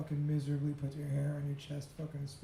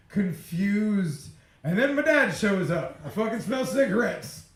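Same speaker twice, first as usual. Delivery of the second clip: ordinary talk, nothing marked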